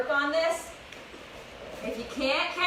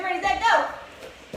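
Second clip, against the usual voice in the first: countdown call; 6 semitones higher, 3 dB louder, much slower than usual. Real, not acted. Starting the timed hop drill.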